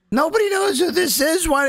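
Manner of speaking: incredulous high voice; high mock confusion. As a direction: sound baffled and squeaky. Quoting a puzzled listener.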